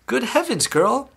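high-pitched mother's exclamation; sudden shriek. A narrator's reading. Mother staring at Violet, shrieking in high alarm. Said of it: "shrieked Missus Beauregard suddenly".

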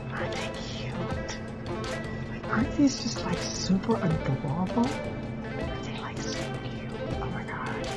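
breathless excited whisper; gushing whisper. Real, not acted. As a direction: whisper it, thrilled. Gushing over earrings, whispered.

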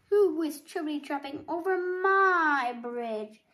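loud, swelling troll challenge; big roaring storybook monster; troll's roar. A narrator's reading. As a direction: boom it out, stretching MY. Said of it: "the troll roared".

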